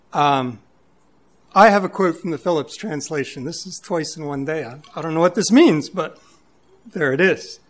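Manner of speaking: loud, emphatic sermon voice; booming pulpit delivery; full-force preaching. Acted. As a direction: preach it to the congregation.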